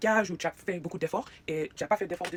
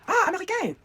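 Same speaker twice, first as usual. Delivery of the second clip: high, loud mock exclamation of recognition; hammed-up "aha!" shout, pitched way up; exclaiming onlookers; 13 semitones higher, 8 dB louder than usual. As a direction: imitate people crying out at spotting an American. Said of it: "la première chose que les gens me disent".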